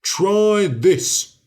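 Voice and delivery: loud challenging giant. A narrator's reading. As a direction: bark it like a giant.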